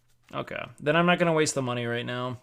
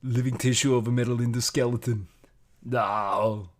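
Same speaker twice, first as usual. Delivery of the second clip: Terminator voice; 2 semitones lower than usual. Retold or imitated. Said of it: "To the Terminator".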